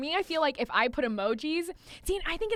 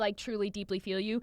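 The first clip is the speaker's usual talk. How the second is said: tender sincerity; 5 semitones lower, 6 dB quieter than usual. Retold or imitated. Voicing her heartfelt red heart.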